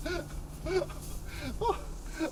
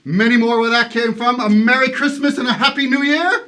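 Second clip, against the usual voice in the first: hearty generosity; 5 semitones lower, 6 dB louder than usual. Acted. Scrooge's generous insistence.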